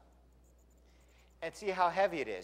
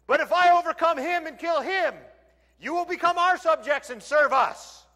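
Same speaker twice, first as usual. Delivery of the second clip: higher, loud taunting challenge; mock giant taunt; 9 semitones higher, 7 dB louder than usual. Goliath threatening Israel's army, loud taunting. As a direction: throw the threat at them. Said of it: "I can imagine what his voice was like".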